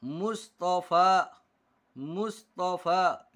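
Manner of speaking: मुस्तफ़ा said very slowly, ending on a plain आ; मुस्तफ़ा drawn out syllable by syllable; slow demo reading. Shown as a demonstration. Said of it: "लंबी ये है, उसको पढ़ा नहीं जाएगा". (मुस्तफ़ा बहुत धीरे साफ़ पढ़ना)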